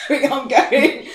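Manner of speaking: high, loud laughing exit line; mock alarm. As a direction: blurt it out loudly. Acts out her alarmed reaction.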